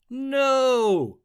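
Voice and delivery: high, loud villain boom. A narrator's reading. Stink bug rejecting the vote, booming. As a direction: roar it to overrule the vote. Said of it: "boomed the stink bug".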